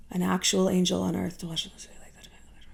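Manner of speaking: soft, fading into a whisper; hushed aside. Real, not acted. Daughter recalling men insulting her angel mother, hushed. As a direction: let it sink away under the breath.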